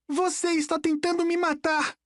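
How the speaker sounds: put-on high, panicked boy's cry; acted shout, pitched very high; shouted accusation. A narrator's reading. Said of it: "gritou Nico".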